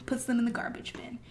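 hushed, breathless whisper. Real, not acted. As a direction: drop to a whisper for the reveal. Dramatic reveal while narrating.

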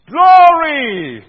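high, very loud cry of praise; a jubilant temple crowd's cry; crowd's shout. Retold or imitated. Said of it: "everyone says".

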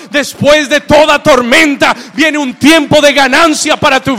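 loud, high, building sermon climax; full-force preaching. Real, not acted. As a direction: build to a shouted promise. (predicación a gritos, en aumento)

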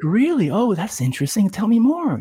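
high, feigned curiosity; pretending to know nothing, high mock-curious; mock interest. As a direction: play innocent and lead him on. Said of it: "I was like".

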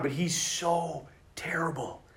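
unvoiced slow whisper. Telling the priests about the guy, whispering slowly. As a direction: whisper it like a secret aside.

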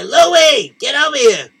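high, loud call across the building; yelling for the janitor. Acted. Calling the janitor.

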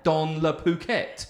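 slow, hammed-up French accent on "Dans le Phuket"; playful mock French accent. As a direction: stretch it in a cartoon French accent. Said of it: "very racist French accent".

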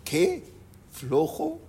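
high squeaky disbelief; incredulous. Retold or imitated. The driver, stunned at being called lazy, squeaks in disbelief. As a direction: echo the insult back, stunned.

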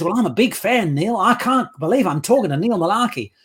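mock-Kiwi super-fan, sing-song; rough imitation of a New Zealand accent; imitated New Zealand accent. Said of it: "Forgive me for the accent".

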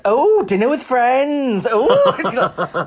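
high, loud, drawn-out mockery; mock-impressed jeer. Hometown friend hearing about a dinner out, high mocking jeer. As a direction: crow it like a scandalised friend.

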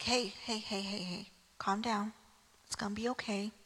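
quiet a panicked friend; soothing hush. Retold or imitated. God soothing a panicked Elijah.

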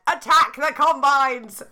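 slow, loud, high battle cry; rally the crowd to charge; angry yell. A narrator's reading. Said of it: "yelled with mad".

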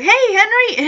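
put-on a cheery child's hello to Henry; excited kid greeting. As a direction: pop in brightly like a little girl. Punky greeting Henry, voiced.

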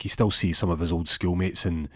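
normal talking voice

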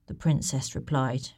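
usual speaking voice

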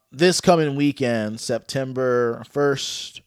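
ordinary talk, nothing marked

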